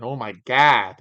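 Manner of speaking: put-on loud, exclaimed Chicago accent. Streamer reacts to the Chicago accent suggestion, in a loud Chicago accent. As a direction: hit it hard with Chicago vowels. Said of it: "Oh, the Chicago accent".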